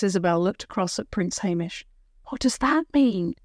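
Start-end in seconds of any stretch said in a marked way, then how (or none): none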